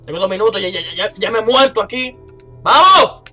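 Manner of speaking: rising into a loud high yell; panicking gamer. Real, not acted. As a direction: panic building to a scream. Pánico antes de morir en juego. (pánico que acaba en grito)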